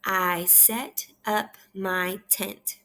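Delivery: word by word, very slowly; unhurried teacher modelling a sentence; slow read-aloud. Shown as a demonstration. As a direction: leave a gap after each word for a learner. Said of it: "Let's read that one more time".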